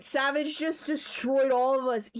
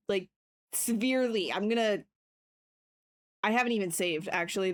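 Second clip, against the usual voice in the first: ordinary talk, nothing marked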